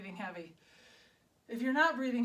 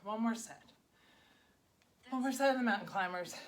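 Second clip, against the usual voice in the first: normal talking voice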